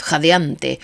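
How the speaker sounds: ordinary delivery, nothing marked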